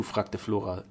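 ordinary delivery, nothing marked

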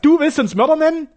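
high, loud roar. Acted. Frankas roars at Sternau in outrage, high and loud. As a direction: bellow in outrage. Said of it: "brüllte Frankas".

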